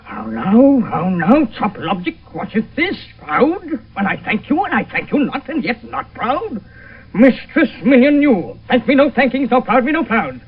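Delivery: loud, raging tirade; furious father. Acted. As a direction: berate the disobedient daughter. Father rages at daughter.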